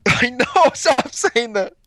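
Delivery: high, loud laughing protest. Real, not acted. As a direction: plead through real laughter, stretching the words. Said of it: "I'm laughing so much".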